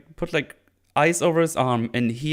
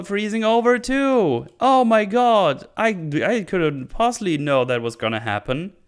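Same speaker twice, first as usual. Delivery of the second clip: higher, louder sarcastic hammed-up alarm; mock naive panic; 7 semitones higher, 4 dB louder than usual. Recapper mocking Nori touching the frozen arm, sarcastic and alarmed. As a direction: ham up a naive girl freezing her own hand.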